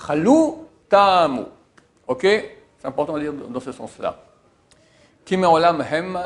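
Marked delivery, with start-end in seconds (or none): 0.1-0.7 "Chalou" rising high onto "lou"; end-stressed "Chalou", pitched up; stress on last syllable. Shown as a demonstration. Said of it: "Sur la fin du mot". 0.9-1.4 "tamu" with a loud "ta", falling away; front-stressed "tamu", dropping on "mu"; stress on first syllable. Shown as a demonstration. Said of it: "l'accent tonique est sur le début du mot".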